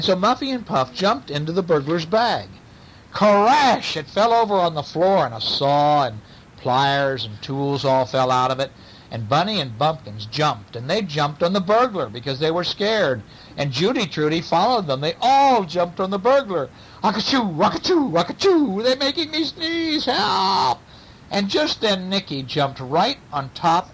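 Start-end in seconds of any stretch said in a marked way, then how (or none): 3.1-4.0 loud, higher, drawn-out exclamation; crash effect. A narrator's reading. Burglar's bag topples over, loud crash. 17.0-20.8 high, helpless sneezy wail; sneezing burglar. A narrator's reading. Cats pile onto the burglar, wailing sneezy panic.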